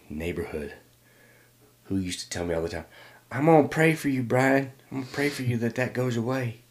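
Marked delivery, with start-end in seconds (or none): 3.3-6.6 put-on neighbor lady's high, loud southern accent. Neighbor lady promising to pray for a boy, in a southern accent. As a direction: pray over them in a raised southern voice. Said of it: "I say it with a southern accent".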